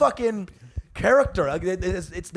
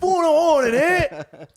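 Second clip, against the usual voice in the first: high, slow, mouth full of hot potatoes cockney; mock British accent; 9 semitones higher, slower than usual. Comic mocking Brits, gargling hot potatoes British accent. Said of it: "like they're gargling hot potatoes".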